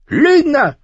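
high, loud cry of "Lügner"; shouting. Acted. Arya shouts at the prince who lied, high and loud. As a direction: hurl the word at him. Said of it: "schrie Arya".

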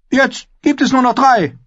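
high-pitched, curt royal retort; high, careless reply; dismissive boy king. A narrator's reading. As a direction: wave the reproach away in a high voice.

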